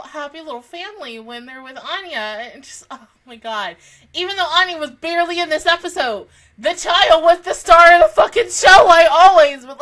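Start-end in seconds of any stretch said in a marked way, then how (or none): 4.1-9.7 high, very loud and emphatic; loud fan outburst. Real, not acted. Fan defending the child star of the show, loud outburst. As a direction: shout it out in high-pitched excitement. Fan gushing over favourite character.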